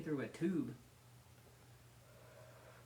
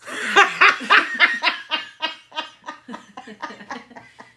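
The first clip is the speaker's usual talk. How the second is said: long loud cackling laugh; loud burst of laughter; 10 semitones higher, 21 dB louder than usual. Real, not acted. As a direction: explode into laughter. Big laugh at joke.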